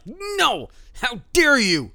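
a high, indignant cry of no, then how dare you; mock outrage. UFC bosses scolding a fighter who asks for money, in mock outrage. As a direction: play the offended UFC boss, hammed-up.

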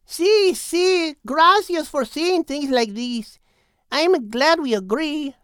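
grateful new friend; Maria warming. A narrator's reading. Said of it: "replied Maria".

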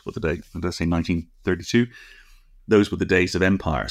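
ordinary talk

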